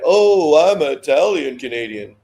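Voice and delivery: mock proud Italian Canadian; smug Italian Canadian. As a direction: put on a snooty show-off voice. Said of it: "superiority kind of play".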